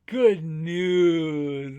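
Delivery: long drawn-out, booming announcement; big storybook proclamation; loud rabbit cheer. Acted. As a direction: belt it out, stretching the words.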